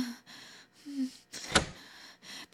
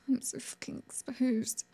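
softer, whispered shame; ashamed whisper; 8 dB quieter than usual. Acted. Woman exposed by her captor, ashamed whisper. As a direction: whisper it in humiliation.

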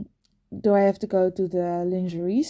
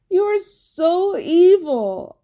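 mock outrage; 7 semitones higher, 5 dB louder, much slower than usual. Accusing him, drawn out.

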